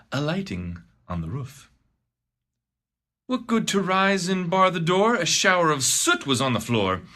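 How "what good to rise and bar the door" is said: ordinary delivery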